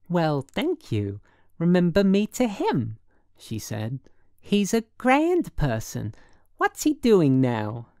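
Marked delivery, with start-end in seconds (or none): 0.1-3.0 high, gushing woman; suddenly friendly. A narrator's reading. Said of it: "She was getting friendly as hell". 4.4-7.9 slow, sociable woman's lilt; friendly as hell. A narrator's reading. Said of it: "She was getting friendly as hell".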